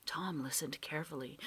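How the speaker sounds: usual speaking voice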